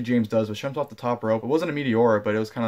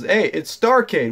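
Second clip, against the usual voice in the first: high, loud, slow mock of a chirpy announcement; mock announcer Lana; 7 semitones higher, 6 dB louder, slower than usual. Vlogger imitating Lana on the mic, loud high drawn-out hype. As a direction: stretch it out high and loud like a hyped host. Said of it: "It says".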